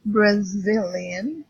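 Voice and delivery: Brazilian, drawn out slowly on "zil"; slow teaching model of Brazilian, rising at the end; stress on second syllable. Shown as a demonstration. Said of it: "the stress is in Z".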